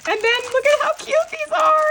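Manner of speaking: high-pitched gushing delight; excited squeal. Real, not acted. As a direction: squeal over something adorable. Gushing over cute gifts.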